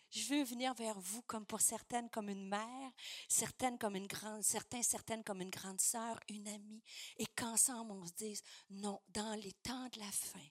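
hushed appeal, drawing the listeners close; tender preaching appeal. Real, not acted. Preacher to the women as mother or big sister, tender hushed appeal.

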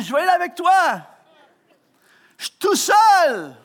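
hammed-up betrayed shout; mock outrage. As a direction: throw the broken promise back at him, then shout alone. Bitter retort at absent organiser.

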